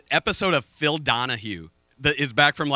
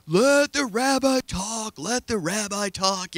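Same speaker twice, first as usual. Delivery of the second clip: pleading call; 4 semitones higher, slower than usual. Retold or imitated. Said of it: "Phil's going".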